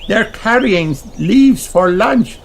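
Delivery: high, bright little pig voice; high child voice; Peppa's voice. Acted. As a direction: play the excited piglet. Peppa's lines in a children's story.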